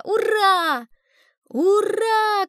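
high, loud repeated hurrah; cheering shout. A narrator's reading. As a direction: cheer together as brothers. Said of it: "кричат братья Измайловы". (радостное громкое «ура»)

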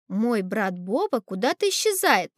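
ordinary delivery

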